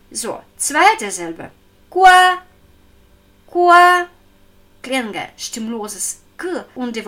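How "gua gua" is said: "Gua" held high and steady, loud and even; level first tone. Shown as a demonstration. Teacher demonstrates the high level first tone of "Gua". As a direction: sing out "Gua" on one high flat pitch. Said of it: "Erster gleichmäßig Heulton".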